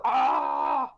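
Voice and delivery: low dumb brute yell; dopey cartoon bellow. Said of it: "also a good impression".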